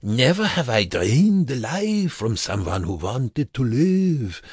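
high, mocking imitation of vampire; bitter mimicry. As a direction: parrot his words back, sneering. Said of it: "Brookman said bitterly, mimicking Vernica".

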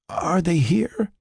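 slow, high, frail question; weak, anxious patient. Acted. Revived patient questions Frank, slow frail high voice. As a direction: ask it slowly and feebly.

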